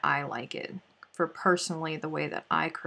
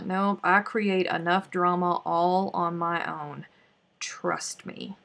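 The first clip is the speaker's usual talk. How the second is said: ordinary talk, nothing marked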